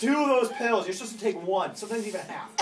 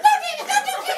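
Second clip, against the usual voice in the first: high falsetto shout imitating a protesting girl; frantic mock protest; 9 dB louder, much faster than usual. Anna begging him to refuse the deal, frantic high mock shout. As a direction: squeal it out loud as a mock plea.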